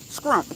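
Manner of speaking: "scrimp" starting with a hard skr; mispronounced shrimp. Retold or imitated. Showing viewers what she hears, "scrimp" with a k. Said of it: "I be hearing a K".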